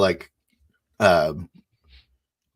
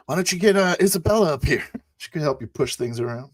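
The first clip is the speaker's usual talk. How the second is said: high, loud, brisk in-character suggestion; 10 semitones higher, 3 dB louder, faster than usual. Acted. Player suggests fetching Isabella to help, high character voice. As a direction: call it out in a high, quick voice. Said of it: "I say, uh".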